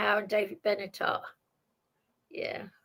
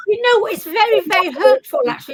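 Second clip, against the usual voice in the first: loud, high, aggrieved; hurt protest; 7 semitones higher, 8 dB louder, faster than usual. Real, not acted. Telling the debater the vegan remark hurt, aggrieved. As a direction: insist over the interruption, voice raised.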